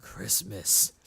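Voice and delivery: a haunted whisper of memory; deep solemn echo. Acted. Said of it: "they go".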